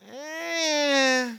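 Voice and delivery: flat "eh" of indifference; unimpressed meh. Shown as a demonstration. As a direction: shrug it off with a meh. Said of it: "You know what I'm talking about?".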